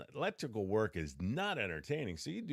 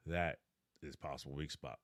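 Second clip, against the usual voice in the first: ordinary delivery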